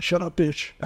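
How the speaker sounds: mock retort. Co-host jokingly acts out a reply to the talking dog, mock retort. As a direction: toss it out as a jokey put-on reply. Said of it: "Were you like".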